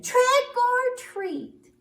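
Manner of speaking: very high, loud, slow call; loud high trick or treat. Acted. Last bat, loudest.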